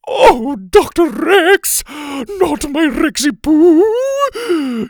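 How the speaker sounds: very high, loud, drawn-out lament; melodramatic wail. Acted. Sloppy Joe runs to unconscious Doctor Rex, wailing. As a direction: wail it, stretching the vowels.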